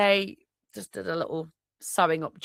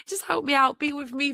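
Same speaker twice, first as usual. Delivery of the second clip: much higher, playful second take; high playful repeat; 9 semitones higher than usual. Shown as a demonstration. Asking the universe again, now high and playful. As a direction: pitch it way up for a cheeky plea.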